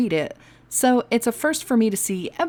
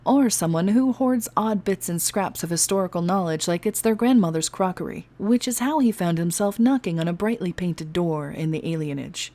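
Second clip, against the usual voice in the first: usual speaking voice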